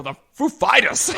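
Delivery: Foo punched high and loud; loud announcer hitting Foo; stress on Foo. Shown as a demonstration. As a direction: hit Foo hard, Fighters falls away. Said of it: "but no".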